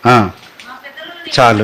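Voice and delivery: normal talking voice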